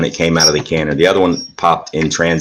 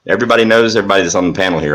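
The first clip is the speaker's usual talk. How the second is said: ordinary talk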